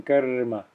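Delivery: karma with the r lingered on; extended r. Shown as a demonstration. Teacher giving the correct form, extended r in karma. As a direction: draw out the r in karma before ma. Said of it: "a little bit extra time".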